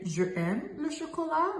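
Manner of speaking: high, soft mimicry of "Je aime"; mocked learner's mistake. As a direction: repeat "Je aime" incredulously small and high, vowels apart. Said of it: "I've heard someone say".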